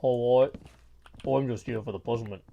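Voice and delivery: put-on retry the line in a low British accent. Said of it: "she's got a British voice".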